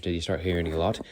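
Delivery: normal talking voice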